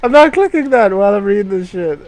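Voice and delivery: loud, high, fast exasperated yell; startled yell. Real, not acted. Streamer yells at a popup mid-reading, fast and loud. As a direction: yelp and rant quickly.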